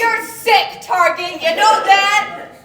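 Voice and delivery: very loud, high, laughing outrage; outraged shouting. Real, not acted. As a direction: yell in disbelief. Horrified shouting at the ad.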